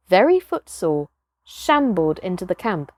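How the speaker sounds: ordinary delivery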